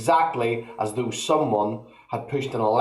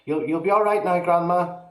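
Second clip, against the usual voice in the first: put-on higher boyish reassurance; calm boy; 7 semitones higher than usual. A narrator's reading. George reassuring grandma, higher. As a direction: brush it off like a cheeky lad.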